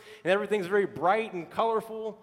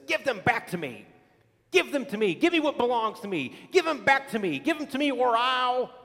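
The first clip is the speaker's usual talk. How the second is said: rising, louder witch demand; wicked witch demanding the slippers; angry witch demand; 5 semitones higher, 4 dB louder than usual. Retold or imitated. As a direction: build the demand higher each time. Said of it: "fuming mad, looks at Dorothy and says".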